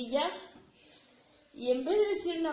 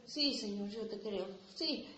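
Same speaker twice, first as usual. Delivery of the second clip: soft, empty mock prayer, only from the teeth out; a hint of hollow piety; half-hearted lip service; 2 semitones lower, 7 dB quieter than usual. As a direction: mumble it like you don't mean it.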